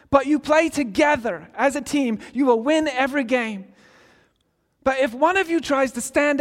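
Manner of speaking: loud, high British coach shouting a halftime speech; British accent. Retold or imitated. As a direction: boom it out like a furious British soccer coach. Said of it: "do that in a British accent".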